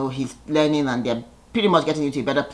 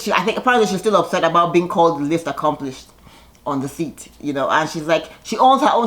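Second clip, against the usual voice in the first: normal talking voice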